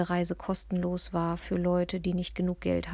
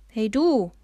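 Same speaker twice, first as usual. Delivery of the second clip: loud call to get attention; sudden call; 3 semitones higher, 9 dB louder than usual. A narrator's reading. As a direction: shout over to someone suddenly. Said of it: "rief mir der Betreuer plötzlich zu". (plötzlich laut zugerufen)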